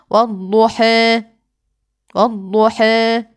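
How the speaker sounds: والضحى with a middle vowel, slow; final of والضحى halfway between open a and e; taqlil reading. Shown as a demonstration. Teacher repeating the in-between vowel. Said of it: "في الوسط ما بينهم".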